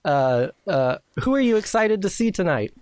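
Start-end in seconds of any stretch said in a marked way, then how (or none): 1.2-2.7 high, eager question; red-carpet interviewer. Pretend. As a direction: put it to the star like an excited reporter. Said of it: "I'm gonna be interviewing".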